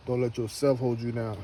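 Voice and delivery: ordinary delivery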